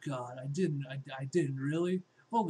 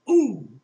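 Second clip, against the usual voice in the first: pained yelp; 9 semitones higher, 15 dB louder than usual. Acted. Sudden pain in fight.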